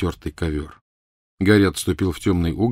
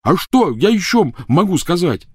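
high, loud yell; 11 semitones higher, 5 dB louder than usual. Acted. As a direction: bellow it back at her. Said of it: "заорал Рон".

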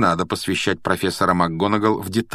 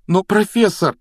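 high, louder interruption; urgent raised-pitch appeal; pleading protest; 12 semitones higher, 5 dB louder than usual. A narrator's reading. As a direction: burst out in objection. Harry protests.

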